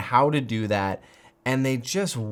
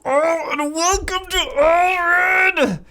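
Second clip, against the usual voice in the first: much higher and louder, slow, yelling welcome; hyped intro shout; 10 dB louder, much slower than usual. Real, not acted. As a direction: open the show screaming with joy. Channel intro hype shout.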